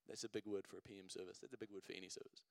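dropped low and quick, half to himself; quiet aside. Real, not acted. Preacher jokes to the congregation about his big word, in a low quick aside. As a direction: toss it off under your breath, fast.

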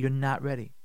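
ordinary talk, nothing marked

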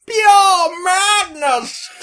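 shouted catchphrase. Acted.

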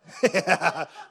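loud amused laugh; hearty laugh; real laugh. Real, not acted. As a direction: laugh at your own joke. Laughs at own joke.

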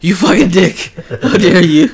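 high, laughing mock outrage; fake-furious scolding through a laugh. Fans scolding the director, mock outraged and laughing. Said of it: "and everyone's like".

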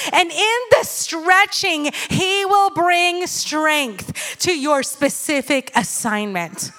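slow, emphatic preaching cadence. Acted. Preacher promising the congregation God's strategy, slow and emphatic. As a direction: drive the promise home to the congregation.

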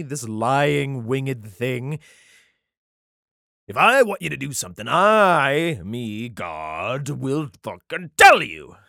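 rising from a sneer to a booming rant; angry deity laying down the law; furious God. Acted. Voicing God's angry rebuke.

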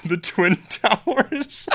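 slow, laughing through the words; giggling out the punchline. Real, not acted.

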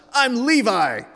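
high, loud acted little-kid protest; shouting. Youngest son correcting his brother, shouting. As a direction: insist on it at a shout. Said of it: "Levi shouts".

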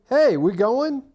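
loud, high, prodding question; retold wake-up call. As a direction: call out to rouse a sleeper. Rousing a sleeping travel companion.

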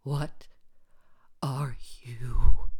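loud, breath-heavy croak; dying croak, halting and forceful; strained croak. A narrator's reading. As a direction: croak it out with great effort, word by word. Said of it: "you croak".

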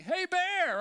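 high, loud call into the woods. Retold or imitated. Hiker calls out to scare off a bear, loud and high. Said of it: "you're like".

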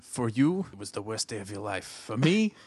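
low, hushed, menacing villain line; a deep murmured movie-villain voice; M. Bison impression. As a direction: quote the dictator with cold quiet menace. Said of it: "famed actor Raul Julia ... M. Bison".

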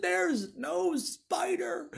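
high, slow words through laughter; laughing. Retold or imitated. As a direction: gloat through the giggles. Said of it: "burst out laughing uncontrollably".

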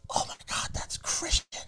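hushed, voiceless awe; excited whisper. Retold or imitated. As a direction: imitate a starstruck kid whispering. Retold kid recognizing him behind his back.

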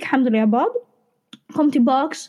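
normal talking voice

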